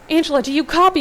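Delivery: high, loud call into the radio; frantic radio shout; scream. A narrator's reading. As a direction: yell it into the walkie-talkie. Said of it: "you scream into the walkie-talkie".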